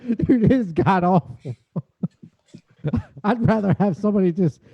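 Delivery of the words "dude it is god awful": usual speaking voice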